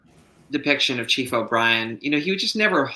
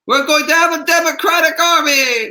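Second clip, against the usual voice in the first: high, loud, grandiose rallying cry; much higher and louder mock speech to the masses; mock revolutionary proclamation; 6 dB louder than usual. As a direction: proclaim it like a hammed-up Bolshevik orator. Mock Bolshevik proclamation, then kidding.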